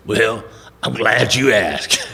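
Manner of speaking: slow, drawn-out mentor impression; Lewis Trotter. Lewis answering Harold's question, slow relish. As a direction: stretch each word like an old bluesman. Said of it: "It's what he sounded like".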